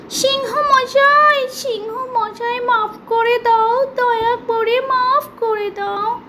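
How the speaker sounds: high, slow squeaky begging voice; frightened mouse pleading with the lion; pleading little mouse. Acted. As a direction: beg for mercy as a tiny mouse. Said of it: "কাঁপতে কাঁপতে কাচু মাচু হয়ে মাফ চাইতে".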